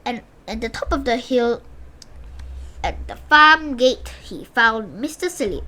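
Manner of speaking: usual speaking voice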